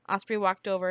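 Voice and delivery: ordinary delivery, nothing marked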